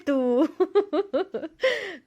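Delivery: high bursting laughter; loud real laugh. Real, not acted. Mom laughing at son's serious book name, loud laughter. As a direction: crack up at the son's words.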